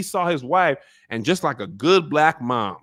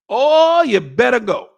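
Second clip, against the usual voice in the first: much higher and louder; loud hype cheer; 10 semitones higher, 9 dB louder than usual. Real, not acted. Viewer cheering Baela on, loud and high. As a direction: yell it like a fan.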